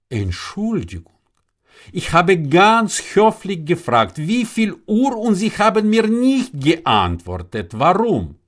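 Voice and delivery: put-on high-pitched, affronted young man; indignant questioning. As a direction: demand to know why you were ignored. Young man demands an answer.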